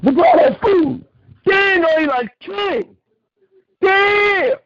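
loud, high-pitched hype; wowed, voice way up and loud; amazed. Real, not acted. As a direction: shout in amazement at the spread. Hyped reaction to food spread.

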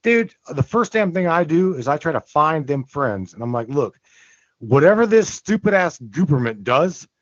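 ordinary delivery

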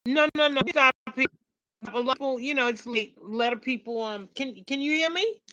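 choppy call audio; words cutting out over a bad line; radio breaking up. Real, not acted. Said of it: "you're very choppy".

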